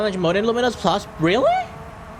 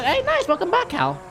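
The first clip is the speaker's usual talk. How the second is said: ordinary talk, nothing marked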